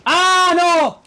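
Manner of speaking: high, loud cry of alarm; alarmed shout. Real, not acted. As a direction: yell out as danger hits. Peligro repentino en el juego. (grito agudo de alarma)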